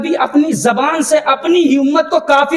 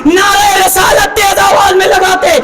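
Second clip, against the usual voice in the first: strained, shouted sermon climax; full-force preaching; 4 semitones higher than usual. Acted. Preacher argues before the slogan, full-force preaching. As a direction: build the argument toward a rallying cry.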